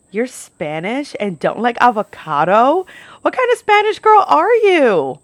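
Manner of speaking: stretched disbelief rising to a loud, high challenge; hammed-up scandalised questioning, building to the end; mock incredulous. Imitating the customer's incredulous challenge.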